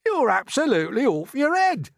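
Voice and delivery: high, loud protesting earthworm; scornful outburst. A narrator's reading. Earthworm attacking James's plan, scornful outburst. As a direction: burst out at him in scorn.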